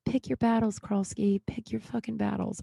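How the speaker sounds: soft aside to oneself; muttered self-reproach. Real, not acted. Muttered advice to herself.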